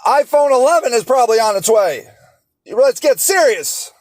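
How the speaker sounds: high, mocking rhetorical questions; sarcastic. Real, not acted. Speaker mocking people who won't give up iPhones, sarcastic. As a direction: needle the listener with biting sarcasm.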